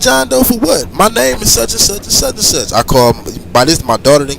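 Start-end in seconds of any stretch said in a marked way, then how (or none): 0.0-2.7 indignant protest. Retold or imitated. Protesting to cop.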